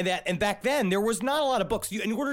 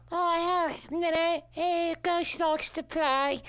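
high, slow, eerie ghost voice; drawn-out spooky ghost impression; 11 semitones higher, slower than usual. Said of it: "she does just sound like a".

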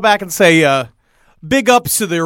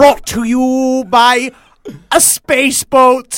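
dark lord; 5 semitones higher, much slower than usual. Acted. Rael voice continues.

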